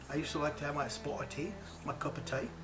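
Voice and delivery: light mock British accent, said quietly; a playful hint of a British accent for the tea line; teasing nod to a British childhood accent. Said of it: "British accent".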